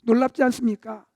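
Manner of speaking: usual speaking voice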